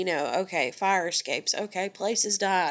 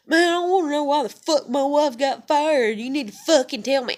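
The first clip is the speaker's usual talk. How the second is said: loud, higher angry demand; irate caller; 7 semitones higher, 6 dB louder than usual. Retold or imitated. Caller demanding to know why his wife got fired, angry. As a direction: imitate a caller demanding answers, voice raised. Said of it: "I'm not talking like that".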